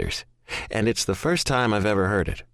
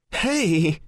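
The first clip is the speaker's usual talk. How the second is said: bright high laughing hello; laughing greeting; 10 semitones higher than usual. A narrator's reading. Woman answering her phone, laughing hello. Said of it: "she laughs".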